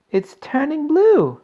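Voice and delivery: slow, very high, loud wail; horrified scream. A narrator's reading. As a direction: scream it out in horror. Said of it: "screamed Missus Beauregard".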